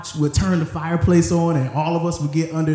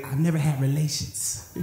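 softer, put-on Mama Klump voice; prim old mama, quieter; Mama Klump impression; 5 dB quieter than usual. Preacher quoting Mama Klump from The Klumps, softer put-on voice. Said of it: "Mama Klump used to say".

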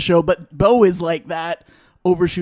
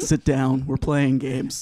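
gruff veteran; 5 semitones lower, 6 dB quieter, slower than usual. Retold or imitated. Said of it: "Erickson's like".

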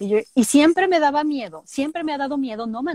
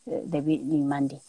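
lowered, soft cartoon girl voice; Mandy voice; 9 semitones lower, 7 dB quieter than usual. Greeting a fan as Mandy, low and quiet. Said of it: "que le mandes un saludito con la voz de Mandy".

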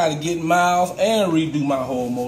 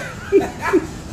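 breathy laugh; 11 semitones higher than usual. Real, not acted. Laughing at friend's joke.

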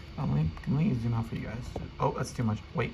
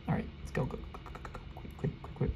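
urgent whisper; 3 semitones lower, 10 dB quieter than usual. Real, not acted. Urgent sneaking in game.

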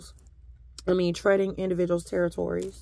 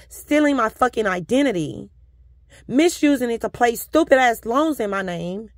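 angry rant; 3 semitones higher, 6 dB louder than usual. Real, not acted. Fuming at identity thieves stealing her money, angry rant. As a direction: spit out the grievance. Ranting about identity theft.

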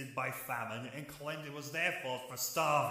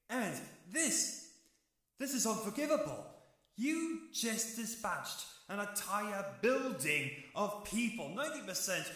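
rising anger; 6 semitones higher than usual. Acted. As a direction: voice climbing higher in fury, pitch up. Brother condemns angel, rising anger.

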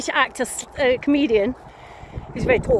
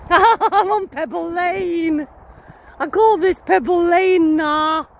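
high, drawn-out Nottingham accent; 5 semitones higher, 3 dB louder, much slower than usual. Shown as a demonstration. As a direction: stretch it out slowly in a Nottingham accent. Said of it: "That's my Nottingham accent".

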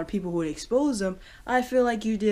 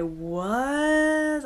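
retold shock; 8 semitones higher, 4 dB louder than usual. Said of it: "I'm like".

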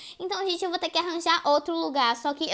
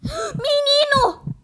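higher, louder, slow gasping cry; gasping fright; 11 semitones higher, 7 dB louder, much slower than usual. Real, not acted. Gamer seeing the killer boy, gasping cry.

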